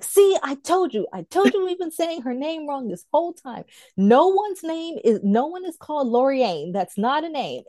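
mock-indignant assistant, emphatic and high; vindicated outburst.

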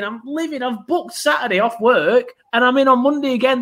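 normal talking voice

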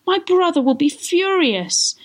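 high, acted wailing; tearful distress. Spinster aunt fearing her brother, high tearful distress. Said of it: "bursting into tears".

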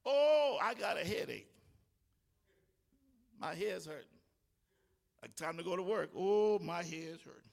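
a hint of a pitiful sick voice; mock sick whine. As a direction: whine like you're faking a headache. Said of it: "you talked yourself into really being sick".

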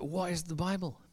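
soft, high, awestruck question; hushed wonder. Retold or imitated. Melody in tears asks her friends, hushed and amazed. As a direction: ask it softly, amazed and tearful. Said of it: "She's like".

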